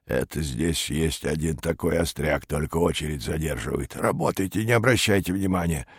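put-on low, soft old man's voice; feeble old man. A narrator's reading. Old man in queue to salesman, feeble grumbling. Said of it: "хилый старик из очереди".

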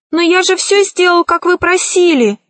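high, plaintive sorcerer voice. A narrator's reading. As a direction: protest pitifully, pitched well up. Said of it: "жалобно произнёс колдун".

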